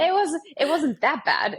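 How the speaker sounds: amused, drawn-out self-defence; laughing insistence. Retold or imitated. Repeats it laughing after teasing.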